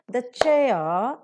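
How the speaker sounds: CHAIR loud and stretched, as a clapped beat; stressed beat. Shown as a demonstration. Teacher claps on each stressed word, heavy stress. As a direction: lean hard on CHAIR. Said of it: "for every stressed word".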